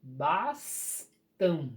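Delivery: bastam broken as bas... tam; slow, pause between "bas" and "tam" in "Bastam"; split into syllables. Shown as a demonstration. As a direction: separate the two syllables of "Bastam". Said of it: "vocês vão separar ela".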